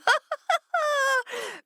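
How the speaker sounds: fishes laughing. A narrator's reading. Fishes mocking the tricked fox, high laugh. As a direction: giggle like a school of fish.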